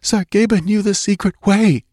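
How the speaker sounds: put-on high, urgent girl's voice; gasped answer. A narrator's reading. Shaken girl answering Conan, gasping. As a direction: blurt it out in fright, high-pitched. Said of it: "she gasped".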